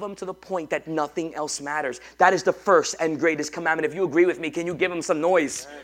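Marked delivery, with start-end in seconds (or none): 2.2-5.7 loud call for the crowd's noise; rousing preaching. Acted. Pastor asking the congregation to give God noise, loudly. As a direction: stir them up to answer.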